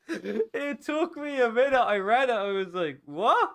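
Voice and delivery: high, laugh-filled telling. Real, not acted. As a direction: talk through the giggles. Still laughing at the email.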